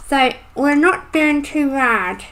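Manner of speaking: talking around a pencil in mouth; mumbled with a pencil between teeth. Real, not acted. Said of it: "I've got a pencil, pencil in my mouth then".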